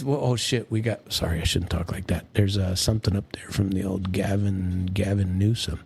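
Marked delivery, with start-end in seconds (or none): none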